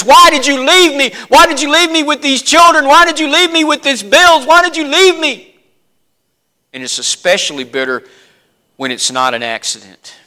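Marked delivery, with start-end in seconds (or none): 0.0-5.5 high, loud anguished pleading; raised lament of a bereaved spouse; grieving spouse's cry. Retold or imitated. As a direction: cry out like a widow left alone. Grieving widow crying out.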